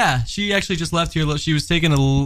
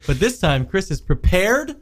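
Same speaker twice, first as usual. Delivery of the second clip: normal talking voice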